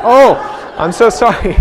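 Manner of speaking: put-on a scared teenager apologising to the cop, high and loud; flustered apology. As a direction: blurt it out, caught and rattled.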